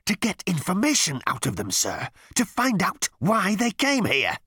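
high, pleading voice, slowing at the end; earnest underling. A narrator's reading. Number two urging his captain, high and pleading. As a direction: press the point like an eager subordinate.

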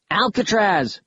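high-pitched call down a shaft; yelling. A narrator's reading. Concerned Kaz yells down the pit to Alcatraz, yelling. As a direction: yell the name, pitched well up. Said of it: "he yelled".

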